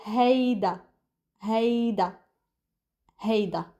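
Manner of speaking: "هيدا" as a clear "HAY-da"; hard d in "هيدا", pause between repeats; Lebanese pronunciation. Shown as a demonstration. Teacher drilling learners, Lebanese pronunciation. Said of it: "It's da".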